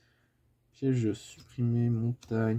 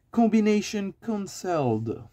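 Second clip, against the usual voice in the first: higher, put-on English accent; 9 semitones higher, 4 dB louder than usual. Reading an English card name aloud, English accent. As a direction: declaim it in English. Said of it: "je suis désolé pour le, l'accent anglais".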